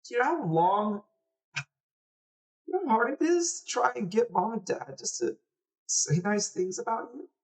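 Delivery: put-on high, aggrieved boy's voice; hurt little brother. Little brother tells sister how he defended her, hurt.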